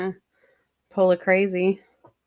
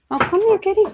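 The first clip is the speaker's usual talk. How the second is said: high, loud coaxing pet voice; calling a pet; 12 semitones higher, 5 dB louder than usual. Real, not acted. Owner calling the kitty over, high pet-talk voice. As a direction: call the cat over in a much higher voice.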